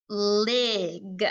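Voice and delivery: "lig" drawn out as a whole; blended word. Shown as a demonstration. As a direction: join the sounds into a slow "lig". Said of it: "One more time".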